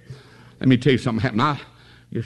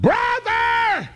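very high shouted cry for brother; hollering for help; much slower than usual. Retold or imitated. Beaten little boy calling for his big brother, high shout. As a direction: call out desperate for help. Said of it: "And he hollered".

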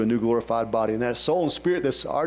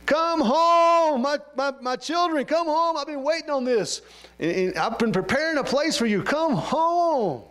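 Jesus calling home; 12 semitones higher, slower than usual. Retold or imitated. Preacher voicing Jesus calling his children home. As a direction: call your children home, longing. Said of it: "he's gonna say something like this".

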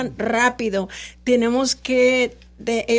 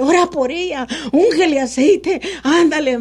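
urgent plea; 6 semitones higher, 6 dB louder than usual. Retold or imitated. Son urgently asks mother to pray.

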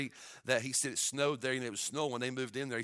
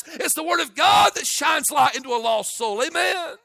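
shouted high and loud, holding every phrase; fiery preaching; 12 semitones higher, 13 dB louder than usual. Acted. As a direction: thunder it at the church like a revival.

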